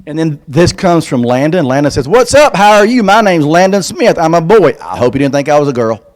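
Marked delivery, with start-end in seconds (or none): 2.1-4.8 put-on higher, louder chirpy kid greeting; little boy's voice. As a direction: bounce through it like an eager kid. Reading boy's letter.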